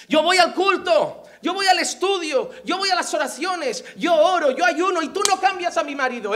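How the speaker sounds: mock an aggrieved churchgoer, pushing each point; demanding complaint. Churchgoer bargains with God over her husband, aggrieved complaint.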